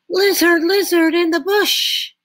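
urgent call. A narrator's reading. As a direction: call out eagerly into the bush. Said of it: "he called quickly".

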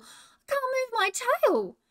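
very high, slow, loud wail; a trapped little animal pleading; panicked kangaroo cry. Acted. Roo trapped under the branch, high slow wail. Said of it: "screamed Roo".

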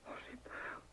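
breathy fearful whisper, barely there; frightened whisper. Acted. Dying wife whispers her fear to her husband.

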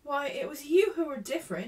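high, loud, indignant exclamation; protesting cry. A narrator's reading. Ermengarde correcting Sarah about their rift, crying out. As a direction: throw the charge back in surprise. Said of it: "she cried".

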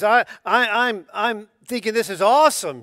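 loud, high, thrilled exclaiming; an elder bursting with joy over his flock; excited John. Retold or imitated. Elder rejoicing over believers, loud excited exclaim. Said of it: "He says".

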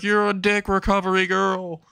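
high, indignant cry; hammed-up outrage; mock yelled insult. Acted yelled retort to nurse.

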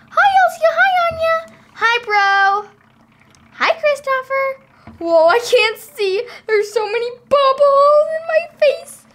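put-on high, bright child voice; high kid voice. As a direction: greet them like a little kid. Child doll voice greeting.

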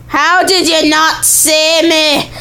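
slow, exaggerated attempt at a British accent; British-ish accent. Shown as a demonstration. Player demonstrating the odd voice, mock British accent. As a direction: show off a vague, hammed-up British accent. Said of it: "some sort of British accent".

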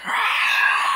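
loud voiceless mock roar; imitated werewolf roar. Reviewer acting out the werewolf appearing, roar. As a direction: roar on rushing breath.

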